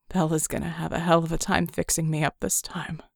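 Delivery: wry, worn-out grumble; lightly voiced Red. Red's voice in story.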